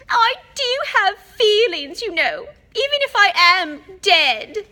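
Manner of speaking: high, loud sobbing wail; crying. A narrator's reading. Ghost girl insists she has feelings, sobbing loudly. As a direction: sob out the self-pity. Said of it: "in a voice choked with tears".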